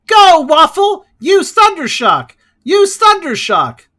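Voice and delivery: loud, high, drawn-out battle command; Pokémon trainer yell. Retold or imitated. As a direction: shout orders like an anime trainer. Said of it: "how fucking great that meme is".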